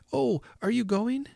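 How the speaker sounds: put-on high-pitched dismayed child; cry of despair. A narrator's reading. Child seeing Father Christmas leave, high despairing cry. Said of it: "I cried in despair".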